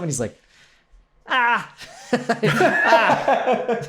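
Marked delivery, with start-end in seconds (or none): 1.3-3.8 high laughing ah; mock awkward laugh, pitched up. Grant reacting to pizza offer, laughing high.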